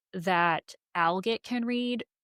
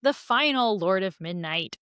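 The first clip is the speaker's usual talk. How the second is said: ordinary talk, nothing marked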